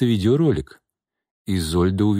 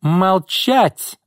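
high-pitched angry shriek; shrieked command; 11 semitones higher, 3 dB louder than usual. A narrator's reading. Boss silences her secretary, shrieking. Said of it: "взвизгнула Изольда".

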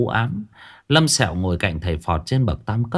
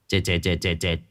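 1 pouting mutter; 4 semitones lower, 5 dB quieter than usual. A narrator's reading. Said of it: "môi bĩu ra đáp".

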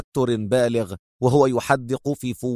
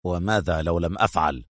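low, soft, calm challenge; firm quiet dare; stern; 7 semitones lower, 5 dB quieter than usual. A narrator's reading. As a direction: defy her coolly. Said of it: "أجابها أدهم في صرامة".

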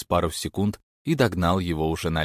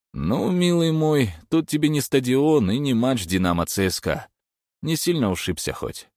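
mock-tender lecture; 7 semitones higher than usual. A narrator's reading. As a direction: talk down to him kindly. Stas lecturing mildly.

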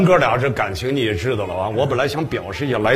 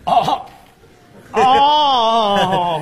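drawn-out realization; 10 semitones higher, 4 dB louder, much slower than usual. Acted. Host suddenly realizes about ticket money.

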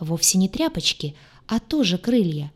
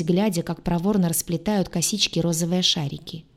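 ordinary delivery, nothing marked

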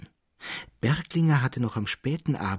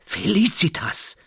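loud, high cry of delight; ecstatic cry; 9 semitones higher, 6 dB louder than usual. A narrator's reading. Traugott cries out to Felicitas in rapture. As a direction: cry her name out in rapture. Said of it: "schrie Traugott auf voll Entzücken".

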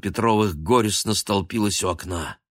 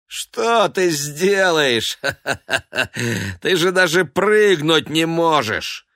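much higher, louder jeer with a cackle; mocking laughter; 10 semitones higher, 6 dB louder, slower than usual. A narrator's reading. Noir jeering at Baguette with a mocking laugh. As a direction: laugh in his face. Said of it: "издевательски засмеялся".

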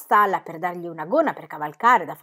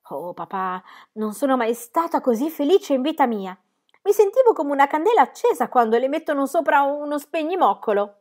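put-on higher, louder excited child; happy girl; 8 semitones higher, 6 dB louder than usual. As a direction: burst out with joy. Molly voiced happy.

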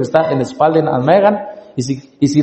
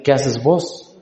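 Chiapas accent, "¿Qué haces vos?" with the local vos, a touch softer; Chiapas way of talking; 3 semitones lower, 5 dB quieter, much slower than usual. Shown as a demonstration. Preacher imitating Chiapas brothers' speech, lightly Chiapas-accented. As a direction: mimic how Chiapas brothers greet, saying "vos". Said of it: "Allá dicen así".

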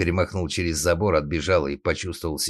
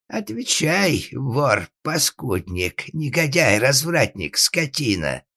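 high-pitched, furious string of insults; enraged father; 9 semitones higher, 3 dB louder, slower than usual. Acted. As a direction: hurl each insult at the son. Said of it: "снова начал свирепеть глава семейства".

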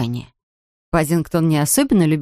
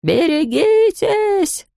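much higher, long drawn-out shout; yelled warning; 13 semitones higher, much slower than usual. A narrator's reading. Foreman warns the crowd, high drawn-out yell. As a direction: bellow the warning. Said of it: "заорал мастер".

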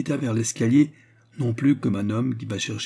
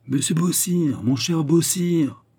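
higher, drawn-out calling; crying out; 7 semitones higher, 3 dB louder, slower than usual. A narrator's reading. Two tipsy agents calling back their host, crying out. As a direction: call after him, stretching the name. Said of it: "à crier".

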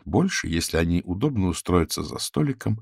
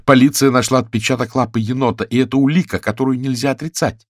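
firm, louder and a little higher; stern; 5 semitones higher, 5 dB louder than usual. A narrator's reading. Reporter confronts the raccoon with police evidence, stern. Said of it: "строго спросила она".

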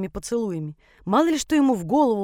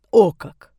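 louder, smirking exclamation; 11 dB louder than usual. A narrator's reading. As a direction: grin through it, teasing. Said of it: "ухмыльнулся он". (с ухмылкой)

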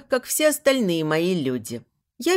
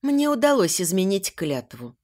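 usual speaking voice

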